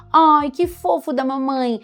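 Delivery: high, loud, drawn-out cooing; exaggerated singsong parent voice; baby talk with intonation. Shown as a demonstration. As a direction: gush over a baby with big swooping intonation. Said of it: "a partir do momento que eu dou entonação".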